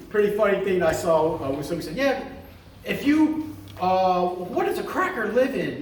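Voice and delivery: ordinary delivery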